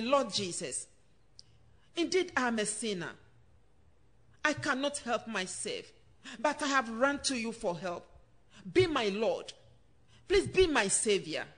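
soft, low sinner's prayer; leading a prayer. Acted. Preacher leading listeners in a soft, hushed prayer. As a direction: pray it gently and very softly for them to repeat.

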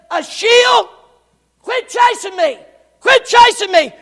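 high loud warning cry; warning shout. Acted. Abner shouting at his chaser to quit, high warning. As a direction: yell at him to back off.

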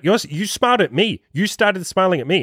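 high, mock accusing; goofy, silly. Bantering with a girl over who smiled first, goofy. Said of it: "kind of this, like, goofy, silly thing".

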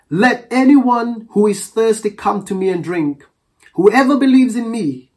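Jesus crying out loud to the crowd at the festival; loud proclamation. Retold or imitated. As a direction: proclaim it to everyone, not softly. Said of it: "It didn't say, he said it in a soft voice".

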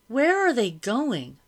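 high, loud, slow indignant question; alarmed question. A narrator's reading. Madam asking where soldiers are going, alarmed. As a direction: ask slowly, pitch soaring. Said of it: "Madam asked".

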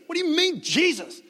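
high, heated outburst; emphatic, exasperated denial. Acted. Peter fed up with being asked about Jesus, emphatic denial. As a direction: lash out at whoever keeps asking. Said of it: "with emphasis".

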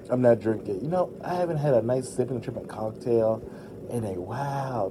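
ordinary delivery

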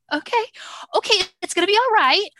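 high, loud anxious okay, okay; anxious self-reassurance. Retold or imitated. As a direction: talk yourself down in a high pitched rush. Self-soothing in a panic.

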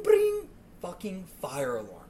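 usual speaking voice

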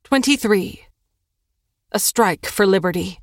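ordinary delivery

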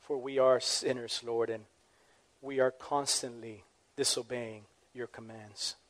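quiet humble prayer to God. Acted. Leads the closing prayer.

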